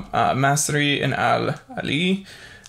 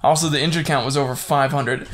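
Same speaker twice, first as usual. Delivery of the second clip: ordinary talk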